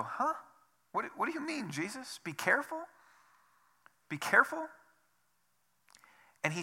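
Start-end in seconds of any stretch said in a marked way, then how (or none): 0.0-4.7 puzzled disbelief. Pretend. Listener confused by Jesus' warning.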